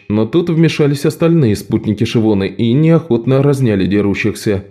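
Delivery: usual speaking voice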